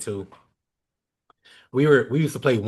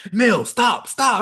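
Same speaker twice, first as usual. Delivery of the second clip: high, loud urgent calling out; urgent plea to stop; 11 semitones higher, 5 dB louder, much slower than usual. Retold or imitated. Assistant coach urgently telling the player to stop, high and loud. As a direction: cut him off, pleading.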